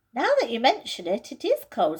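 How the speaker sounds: Peter's voice. Acted. Peter grumbles in the cramped wardrobe, uncomfortable. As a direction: squirm as you say it.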